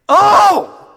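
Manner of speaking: loud, high crying out Oh!. Shown as a demonstration. Preacher showing a desperate cry to God, loud and high. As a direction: wail Oh! up to God. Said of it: "It's like".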